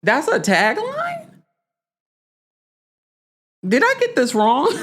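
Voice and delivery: incredulous. Real, not acted. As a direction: stretch it out, you can't believe it. Disbelief at the tagline.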